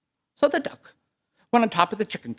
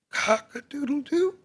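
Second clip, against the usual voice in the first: high, small rooster crow; soft tentative crow; 9 semitones higher than usual. A narrator's reading. Rooster first tries crowing on coop, soft and tentative. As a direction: crow timidly, rising at the end. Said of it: "he said softly".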